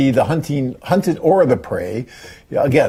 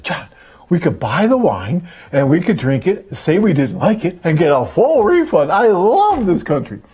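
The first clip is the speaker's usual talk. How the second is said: excited wife, imitated; 5 semitones higher than usual. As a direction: play your wife gleefully hatching a plan. Wife excitedly plotting refund, retold.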